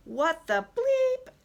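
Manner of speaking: loud, high, drawn-out mock outrage; retold outrage. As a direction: stretch it out and peak on the self-censoring bleep. Retells finding herself locked out.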